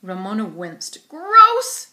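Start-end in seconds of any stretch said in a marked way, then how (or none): none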